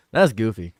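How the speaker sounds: ordinary talk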